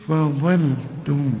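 فضلتم slow with a thin ف and a thick ظ; light fa, heavy dha. Shown as a demonstration. Teacher modeling the word slowly for the student. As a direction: keep the ف light and make the ظ full. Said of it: "شوف".

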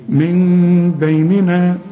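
من بيننا slow, nun turned into a half mim with lips apart; half-open lips. Shown as a demonstration. Teacher shows the iqlab for the student. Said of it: "انفراج في الشفايف".

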